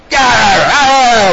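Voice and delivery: high, loud wordless scream; mimicking a man screaming in a scuffle; imitated yelling. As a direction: burst out in a mock brawl yell. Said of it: "like this, and all yelling and screaming".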